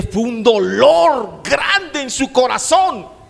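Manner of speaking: impassioned preaching. Acted. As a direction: cry out Peter's regret to the congregation. Preaching Peter's bitter weeping. (predicación apasionada, aguda y lenta)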